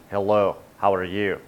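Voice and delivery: low-pitched mock Russian greeting; Russian accent. Shown as a demonstration. Host demonstrating vocal range to viewers, low Russian accent. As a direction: drop low for a rough attempt at Russian. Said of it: "Or you could do Russian".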